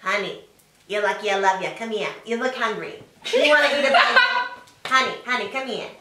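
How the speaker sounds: put-on New York accent of an old smoker; old Long Island smoker. Showing off a New York voice, old Long Island smoker.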